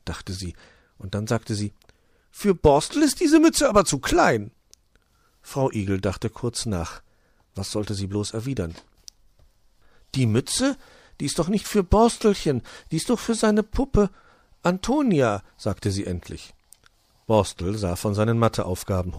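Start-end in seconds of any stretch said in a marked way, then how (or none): 2.3-4.5 much higher, louder magpie; a nosy magpie pointing out a flaw; high sharp-eyed magpie. Acted. Magpie voiced, suspicious remark. 10.1-15.4 much higher, fussing hedgehog mother; higher voiced mother hedgehog fibbing; hedgehog mother covering up. Frau Igel covering a secret.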